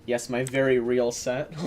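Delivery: usual speaking voice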